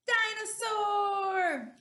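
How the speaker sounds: loud, high, drawn-out announcement; big excited reveal. Acted. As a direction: announce it slowly and triumphantly to kids. Announcing the answer to clues.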